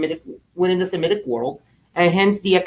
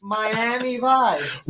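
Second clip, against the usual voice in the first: slow, high, drawn-out Miami vibes; slow drawled vibes; 5 semitones higher, much slower than usual. Pretend. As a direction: stretch out the word vibes. Slower repeat of catchphrase.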